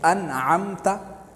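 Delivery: अनअमता said very slowly with a clear n before the ain; example word. Shown as a demonstration. Teacher showing izhar to students, slow and deliberate. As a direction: spell out अनअमता slowly, keeping the n audible. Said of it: "किस तरह?".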